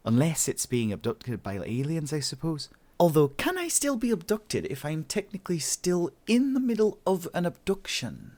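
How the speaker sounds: high, musing self-talk; Jen thinking aloud, puzzled. A narrator's reading. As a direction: puzzle it out to yourself, high and questioning. Voices Jen fretting to herself.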